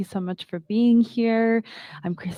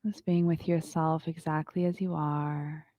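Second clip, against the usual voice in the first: calm, unhurried yoga teacher voice; guided meditation; 4 semitones lower than usual. Acted. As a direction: guide the class into stillness. Leads a yoga breathing meditation.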